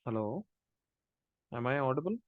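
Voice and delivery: faint voice on a bad line; speaking too softly; barely audible. Real, not acted. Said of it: "your voice is very low".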